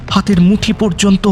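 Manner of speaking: plain reading voice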